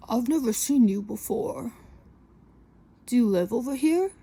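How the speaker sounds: mock-curious creepy man; creepy guy voice. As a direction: lean in like a nosy stranger.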